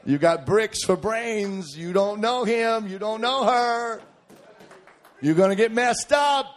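high, slow, drawn-out warning; preacher's exaggerated, rising admonishment; mock scolding. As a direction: stretch each phrase into a singsong rebuke. Preacher scolding lovestruck teens.

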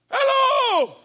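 high, drawn-out, loud hello; long high-pitched call in answer; calling back. Retold or imitated. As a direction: holler a greeting back from afar. Friend hollers back across the town.

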